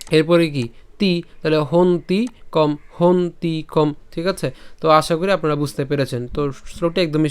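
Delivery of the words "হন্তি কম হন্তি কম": slow হন্তি, then separate কম; pronunciation demo. Shown as a demonstration. Teacher shows how to pronounce it, slow হন্তি কম. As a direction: break it syllable by syllable into হন্তি কম. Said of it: "হন্তিকম উচ্চারণ কীভাবে করব".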